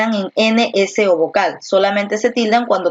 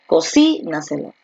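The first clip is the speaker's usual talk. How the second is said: Cocínaselo stressed on CI; stress on second syllable; 3 semitones lower than usual. Shown as a demonstration. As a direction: hit CI in Cocínaselo, then drop. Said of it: "¿Dónde está la fuerza de voz".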